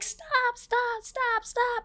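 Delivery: very high imitated cries of kids freaking out. Kids watching a pool fight turn deadly, high mock panic. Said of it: "freaking out".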